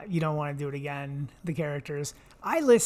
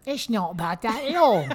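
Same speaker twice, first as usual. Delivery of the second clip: silly British character voice, higher; weird British accent; 5 semitones higher, 4 dB louder than usual. Acted. Pirate character teasing back, weird British accent. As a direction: put on an odd, comic British voice. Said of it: "it's, like, British and weird".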